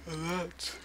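talking through a yawn. Real, not acted. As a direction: yawn mid-word. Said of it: "I'm sorry for yawning".

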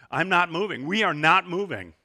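put-on loud, firm child's refusal; emphatic refusal. Daughter refusing to move, loud emphatic no. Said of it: "she was emphatic".

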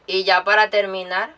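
ordinary delivery

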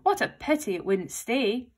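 ordinary delivery

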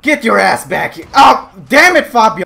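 loud, high yelling; exasperated shout. Real, not acted. Rider to runaway horse Fabio, exasperated shout. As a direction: bawl out the horse.

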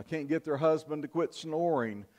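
ordinary talk

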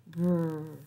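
"brr" as a rolled-on onset with no vowel; isolated onset "br" for children; beginning sound isolated. Shown as a demonstration. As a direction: hold the "br" blend alone. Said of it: "tell me the beginning sound".

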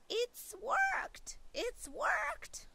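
high breathless joy; grandma amazed, high and hushed; excited whisper-cry. Acted. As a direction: gasp it with delight. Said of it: "she cried".

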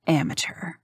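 soft unvoiced sneer to herself; scornful whisper. A narrator's reading. Rook judging her opponent's opening, whispered scorn. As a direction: dismiss him under her breath.

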